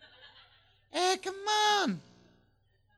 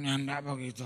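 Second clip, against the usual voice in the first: put-on drunk husband; 7 semitones lower, 5 dB quieter than usual. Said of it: "Suaminya dengan mabuk jawab".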